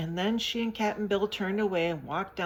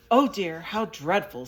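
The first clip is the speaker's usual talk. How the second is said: louder mock wail; hammed-up wailing lament; 2 semitones higher, 10 dB louder than usual. A narrator's reading. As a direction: wail it out like a pessimist. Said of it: "said Pessum in a wailing voice".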